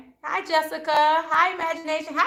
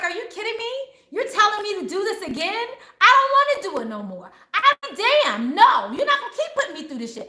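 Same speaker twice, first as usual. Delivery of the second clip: retold outburst, pitched up and insistent; passionate protest; 6 semitones higher than usual. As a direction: plead and refuse, re-enacting your passionate rant. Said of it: "I was so passionate".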